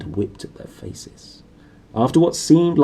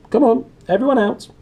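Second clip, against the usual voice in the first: high, loud eager command; eager order; 13 semitones higher, 6 dB louder than usual. A narrator's reading. Uncle Vernon orders his family out of the car, high and eager. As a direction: hurry them out gleefully.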